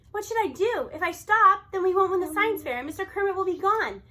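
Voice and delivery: plain reading voice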